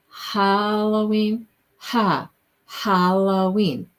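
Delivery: Halloween drawn out slowly, long "haa"; sounding it out. Shown as a demonstration. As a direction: stretch the first syllable of Halloween. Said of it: "is that how I'm saying it".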